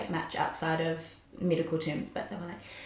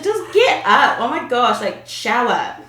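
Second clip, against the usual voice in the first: much louder, higher, laughing order to shower; God's blunt nudge; 5 semitones higher, 12 dB louder, slower than usual. Retold or imitated. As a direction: bark it like an exasperated parent. Voicing God's blunt advice.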